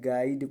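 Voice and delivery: गाइड as "gaa-id", u silent; correct pronunciation. Shown as a demonstration. Teacher giving students the reading, silent u. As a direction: say गाइड with an "ai" glide and no u. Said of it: "इसलिए इसको क्या पढ़ा जाएगा".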